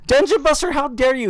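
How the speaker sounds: a play-angry banishment; mock outrage. Streamer exiling a chatter in hammed-up mock rage.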